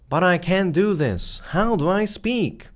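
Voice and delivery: lightly voiced anxious learner; mock worried beginner. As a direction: voice the viewer's panic, lightly. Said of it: "Don't worry, bro".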